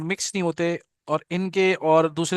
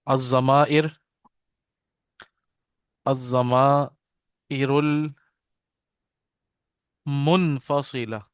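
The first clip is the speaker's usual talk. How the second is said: very slow, each syllable drawn out; slow careful model reading; much slower than usual. Shown as a demonstration. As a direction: model it slowly for students to repeat. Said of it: "पढ़िए मेरे साथ".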